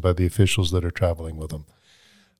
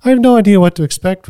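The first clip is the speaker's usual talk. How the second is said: excited; 12 semitones higher, 8 dB louder, faster than usual. Real, not acted. Excited laughing admission.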